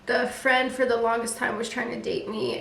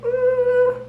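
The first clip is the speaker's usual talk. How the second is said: loud, high-pitched mock ooh; high wary ooh; 14 semitones higher, 6 dB louder than usual. Advising friend to leave a murderer, high wary ooh. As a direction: let out a high, uneasy ooh. Acts out wary reaction to confession.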